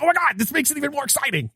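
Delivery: high, fast, thrilled gush; excited gush. Retold or imitated. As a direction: let it burst out fast and high. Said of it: "you're like".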